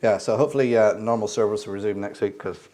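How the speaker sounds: ordinary talk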